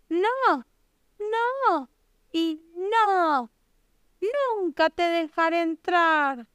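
high, defiant piglet refusal. A narrator's reading. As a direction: refuse firmly in a high piggy voice. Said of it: "La cerdita respondió". (cerdita con voz aguda y firme)

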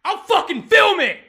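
high, very loud threat; threatening shout. Real, not acted. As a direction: yell it as an ultimatum. Furious threat shouted on call.

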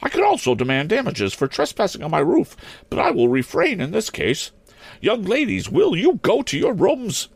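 put-on high-pitched stern old maid principal; demanding schoolmistress. A narrator's reading. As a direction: give orders in a high headmistress voice. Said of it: "she ma-, demanded".

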